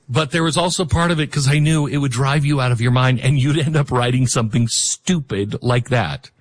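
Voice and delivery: ordinary delivery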